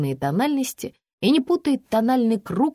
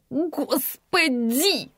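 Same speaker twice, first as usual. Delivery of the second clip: long, slow exasperated hiss; drawn-out higher-pitched hiss; 8 semitones higher, much slower than usual. A narrator's reading. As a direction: hiss it out syllable by syllable. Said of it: "прошипела тётка".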